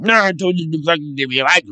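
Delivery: a hint of a mumbled gruff boss; lightly mushed words; mild imitation of his boss; imitating Chuck. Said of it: "he's like".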